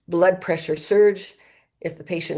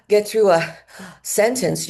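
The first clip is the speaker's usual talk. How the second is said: breathy gasping voice; 2 semitones higher, 3 dB louder than usual. Shown as a demonstration. Doctor showing students a patient's breathy ventilation voice. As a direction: gasp for air mid-sentence. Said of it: "what I call the breathy voice".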